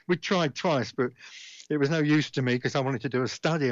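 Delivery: usual speaking voice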